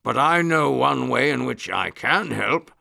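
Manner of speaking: big lion voice held back; roaring lion trying gentleness. A narrator's reading. Lion admitting he is too large, trying gentleness. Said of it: "in as gentle a voice as he could make".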